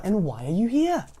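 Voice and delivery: fussy questioning. A narrator's reading. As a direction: ask it pointedly over your shoulder. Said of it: "he asked over his shoulder".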